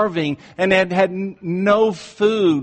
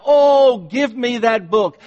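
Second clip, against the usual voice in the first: high, loud pleading cry; cried out; 7 semitones higher, 5 dB louder than usual. Retold or imitated. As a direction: cry it out like a preacher begging. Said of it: "he cried".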